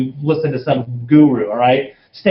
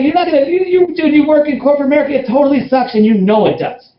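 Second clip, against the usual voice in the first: much higher, excited challenge; pumped-up, pitched-up challenge; fired-up pitch; 7 semitones higher than usual. Retold or imitated. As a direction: push him hard, voice way up. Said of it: "So I'm talking like that".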